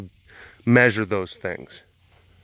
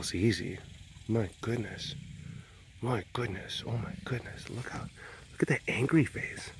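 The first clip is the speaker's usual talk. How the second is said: hushed pet talk; soft cooing to a pet; 10 dB quieter than usual. Real, not acted. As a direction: coo over the pet up close. Cooing over pet on camera.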